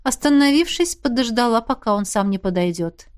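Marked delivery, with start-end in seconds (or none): none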